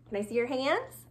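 ordinary talk

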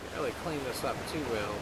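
normal talking voice

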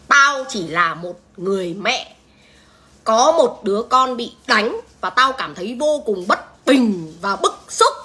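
each word slowly laid down; a wronged mother speaking slowly and firmly; slow, deliberate. Real, not acted. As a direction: spell out your outrage, very slowly. Slow indignant mother's statement.

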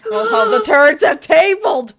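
loud, high mock-dramatic line; dramatic exclamation. Real, not acted. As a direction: declare it theatrically. Player joking dramatically.

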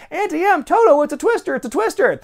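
very high hammed-up cry of alarm; high-pitched parody of storm panic; mock movie panic.

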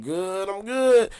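high, slow, drawn-out relieved exclamation; retold relief. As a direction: stretch it out high, as if off the hook. Retold relief at only a citation.

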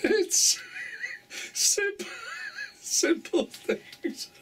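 high, squeaky words through laughter; laughing so hard the words barely come out; real helpless laughter. Real, not acted. Cracked up by own Tesco's joke, laughing helplessly.

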